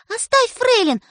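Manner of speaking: high, loud cry; shout. A narrator's reading. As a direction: yell at him to let go. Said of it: "кричит".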